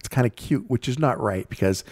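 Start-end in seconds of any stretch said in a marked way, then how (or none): none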